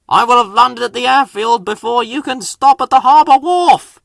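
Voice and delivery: loud, high boastful helicopter; proud boast. A narrator's reading. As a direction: brag over the noise. Harold boasts mid race.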